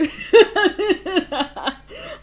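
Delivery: high, loud helpless laughing; loud laughter. Real, not acted. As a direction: lose it completely, laughing hard. Colon joke misunderstood.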